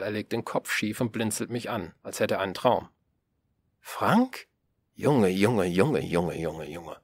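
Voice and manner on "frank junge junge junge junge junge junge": stunned then fading. Acted. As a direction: let the amazement drain away.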